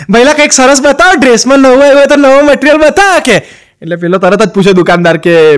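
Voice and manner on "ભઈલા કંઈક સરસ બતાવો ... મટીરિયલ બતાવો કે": raised, coaxing shopper voice; lightly voiced coaxing customer; sweet-talking sister-in-law. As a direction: play the bhabhi sweet-talking the shopkeeper, pitched up. Sister-in-law voiced asking shopkeeper.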